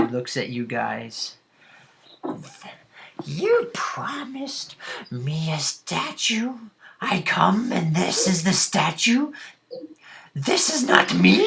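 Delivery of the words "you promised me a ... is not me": high, drawn-out wail of an offended ghost; indignant ghostly elf. Acted. Ghost to party who promised a statue, indignant high wail. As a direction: accuse them in a high, rising ghost voice.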